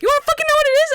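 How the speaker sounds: high, loud incredulous retort; re-enacted yelling. Canoer snaps at friend about spider, high incredulous yell. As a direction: shout back in panicked disbelief.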